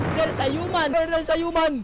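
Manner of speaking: high-pitched rallying cry; mock alarm. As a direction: rouse the pack in pretend alarm. Dinos raise alarm on human. (kunwaring nag-aalarmang sigaw)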